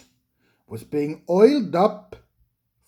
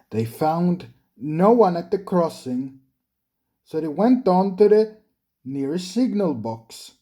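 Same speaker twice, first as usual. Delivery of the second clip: plain reading voice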